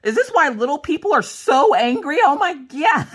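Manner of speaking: high, worked-up mock rant; angry outburst. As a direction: act out being hangry, high and heated. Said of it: "so angry all the time".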